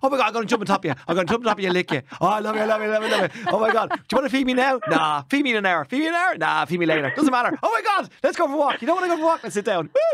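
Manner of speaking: dog voice impression. Imitating his dog greeting the owner, high and overexcited. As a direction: gush like a dog thrilled you're home. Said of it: "your impression of your dog".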